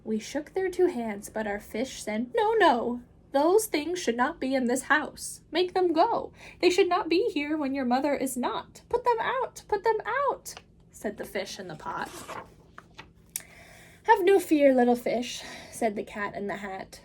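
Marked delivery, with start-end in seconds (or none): 2.4-10.5 much higher, louder, indignant fish; the fish scolding the cat for wrecking the house. Acted. Fish demands Things go. 14.0-15.4 bright, higher, louder showman cat; the Cat in the Hat calming the children, big and upbeat. Acted. Cat reassures fish.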